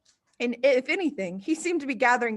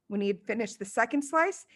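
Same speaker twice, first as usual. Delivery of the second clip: ordinary delivery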